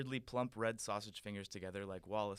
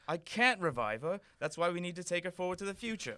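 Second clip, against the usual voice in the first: very loud, fast cockney; cockney Doctor; 7 semitones higher, 8 dB louder than usual. Acted. The Doctor offering to help the prince, in loud cockney. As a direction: boast in brash cockney.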